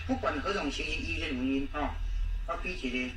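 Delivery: ordinary talk, nothing marked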